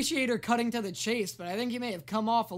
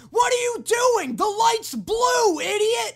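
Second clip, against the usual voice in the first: furious honking driver's yell, much higher and louder; angry driver yelling; 11 semitones higher, 10 dB louder, slower than usual. Retold or imitated. Angry Japanese driver yells at me in traffic, furious shout. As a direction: scream at the lost foreigner blocking the road. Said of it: "Guys would be laying on the horn behind me like".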